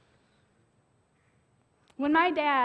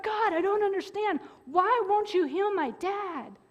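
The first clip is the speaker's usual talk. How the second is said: retold pleading prayer; 5 semitones higher than usual. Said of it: "I'm just bawling".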